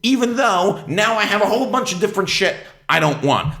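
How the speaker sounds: ordinary talk, nothing marked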